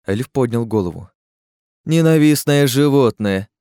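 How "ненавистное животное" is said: scornful snort. A narrator's reading. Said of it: "хмыкнул он и закашлял".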